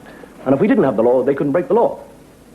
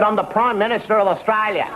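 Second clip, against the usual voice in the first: higher-pitched mock Australian accent; 5 semitones higher than usual. Prime Minister introduces himself at heaven's gate, Australian accent. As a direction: put on a broad Australian politician.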